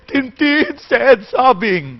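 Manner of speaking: high, slow, sobbing read of the line; mock sobbing narrator. Shown as a demonstration. Teacher showing the wrong way, hammed-up sobbing. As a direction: overdo the tears as the narrator would not. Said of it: "Then why are you crying?".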